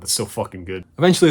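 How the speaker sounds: ordinary delivery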